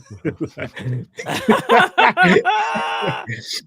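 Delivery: cracking up with a loud laugh; high loud laugh. Real, not acted.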